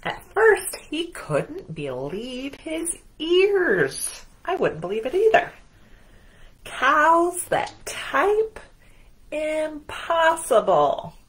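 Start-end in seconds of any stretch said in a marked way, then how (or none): 0.0-4.2 slow, drawn-out storybook narration; dramatic storytelling. A narrator's reading. To children, slow wondering read-aloud. 6.6-11.1 slow, higher, incredulous; can't believe cows can type; disbelief. Acted. The farmer, astonished, slow incredulous voice.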